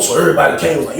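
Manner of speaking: usual speaking voice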